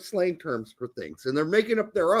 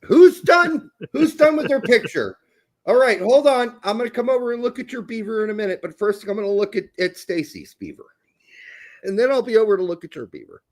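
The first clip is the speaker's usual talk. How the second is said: higher, louder start, then quick instructions; teacher calling out; 4 semitones higher, 3 dB louder than usual. Acted. Teacher checking the students' beaver drawings, calling out briskly. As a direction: call across the class, then hurry through who's next.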